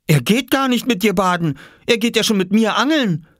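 high-pitched insistent denial; indignant little boy. Acted. Oskar contradicting Jule about his friend, indignant.

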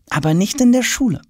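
put-on high-pitched boy's voice; hinting boy. A narrator's reading. Tom winks at father while hinting, boyish. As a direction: drop a hint in a high boyish voice.

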